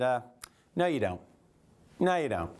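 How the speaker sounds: calm, smiling no, you don't; smiling and unbothered, teasing him; smug denial. Retold or imitated. Advisor teasing a rude client, smiling and smug. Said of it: "sat back and smiled and said".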